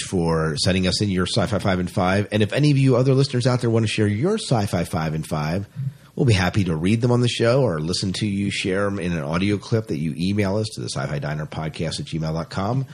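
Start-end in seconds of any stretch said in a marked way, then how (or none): none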